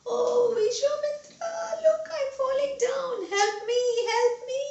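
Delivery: very high, stretched wail of panic; screaming for help. A narrator's reading. Trishanku tumbling from heaven calls to the sage, screaming. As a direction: cry out as you plummet, voice way up. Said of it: "he started screaming".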